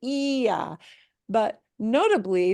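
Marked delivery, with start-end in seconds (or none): 0.0-0.8 "Ia" as EE-ah, high on the i; "Ia" in two syllables, weight on the i; stressed i in ia. Shown as a demonstration. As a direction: punch the first vowel of "Ia". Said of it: "this does not have the ia punch".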